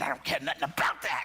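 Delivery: emphatic imitation of father; stern dad. Father brushing off son's question, emphatic dad voice. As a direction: dismiss it like a hard-handed dad. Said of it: "He said".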